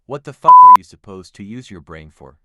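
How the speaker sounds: loud, exasperated shout; angry yell. Acted. Boss yelling at his assistant about his idea, exasperated shout. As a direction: blow up at the fool.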